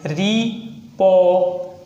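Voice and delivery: Report in separate sounds, "ree", "po", "t"; sounded out slowly. Shown as a demonstration. Teacher telling students to follow sound, not spelling, sounded out slowly. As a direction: sound out report with long gaps between parts. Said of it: "sound को आपको देखना है".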